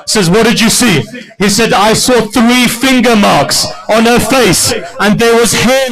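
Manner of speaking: high, loud wailing recitation; preaching at full force. Acted. Preacher voicing Imam's memory of Sakina, full wailing. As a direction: pour out the grief at the top of the voice. Final climactic narration with wailing.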